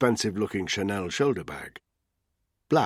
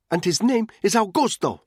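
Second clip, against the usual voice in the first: high, louder defiant retort; a mother snapping at a thug, higher and louder; 13 semitones higher, 7 dB louder than usual. A narrator's reading. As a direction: fling it back over the shoulder. Anna corrects the man.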